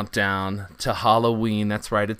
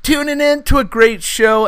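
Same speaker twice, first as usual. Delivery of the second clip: hyped radio host; 8 semitones higher, 9 dB louder than usual. Acted. Hyped podcast intro.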